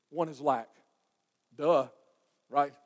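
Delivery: usual speaking voice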